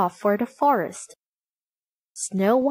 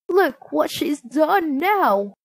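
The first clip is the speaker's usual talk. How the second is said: high, slow exclamation; dismayed dwarf; 6 semitones higher, slower than usual. A narrator's reading. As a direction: lament the latest mischief as a dwarf. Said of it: "said one".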